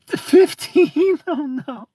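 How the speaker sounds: usual speaking voice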